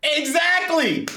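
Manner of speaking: high, long-drawn exclamation with laughter; high laughing cheer of agreement; gleeful agreement. Real, not acted. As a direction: stretch it out in delighted agreement.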